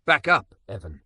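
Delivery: a sharp loud call; loud and clear. A narrator's reading. Trina warning Evan off from behind Malik, loud. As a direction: cut in hard and clear from behind. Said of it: "loud and clear".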